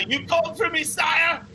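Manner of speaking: tiny strong dwarf answering his master; eager dwarf servant. Acted. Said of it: "he's like".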